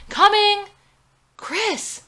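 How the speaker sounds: very high, loud double shout; shouting toward the banging door; frantic shout. Acted. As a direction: yell at the door and phone at once. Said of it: "screams into the phone and at the door".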